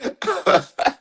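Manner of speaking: a squealing high-pitched laugh; high giggle. Real, not acted. Cracking up at her own teasing, high squealing laugh. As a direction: burst into a shrill, breathy giggle.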